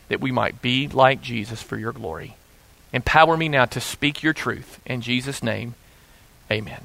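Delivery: soft, hushed pastoral prayer; an earnest spoken prayer, voice dropping low at the close. Real, not acted. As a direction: pray quietly and reverently over the word. Pastor prays before the sermon.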